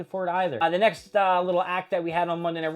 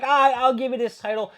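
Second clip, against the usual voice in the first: mock sarcastic handover; 10 semitones higher, 6 dB louder than usual. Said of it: "Io Sky's like".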